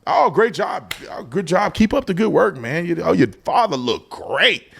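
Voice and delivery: high, slow, hearty mock Trump praise; lightly voiced Trump, easygoing and upbeat; friendly Trump greeting. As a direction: imitate a boss slapping your back with compliments. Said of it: "he just talk to you like a regular person".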